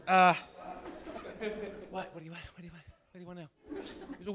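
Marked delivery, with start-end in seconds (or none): none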